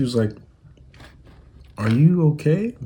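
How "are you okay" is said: slow, higher, puzzled question; drawn-out, high-pitched incredulous check; mock concern. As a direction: imitate someone asking if he has lost it. Imitating fiancee's baffled concerned question.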